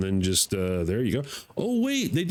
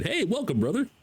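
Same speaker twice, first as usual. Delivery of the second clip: high-pitched Canadian greeting; Canadian accent; 10 semitones higher than usual. Acted. Player voicing Canadian game character, high Canadian accent. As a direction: put on a friendly Canadian accent. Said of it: "Oh, he's Canadian".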